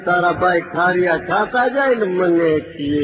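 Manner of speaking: ordinary delivery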